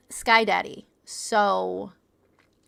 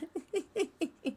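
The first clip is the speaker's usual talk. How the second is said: high, soft giggle; giggling; 7 semitones higher, 9 dB quieter than usual. Real, not acted. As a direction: let a quick light giggle bubble out. Amused by own prank.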